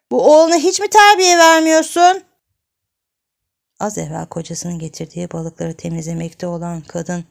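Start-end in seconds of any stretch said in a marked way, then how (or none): 0.1-2.2 put-on high, loud indignant old woman; complaining granny. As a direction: scold the mother about her son. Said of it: "Gözleri dolan koca nine ... şikayete geldi". (yüksek sesle sitem eden koca nine)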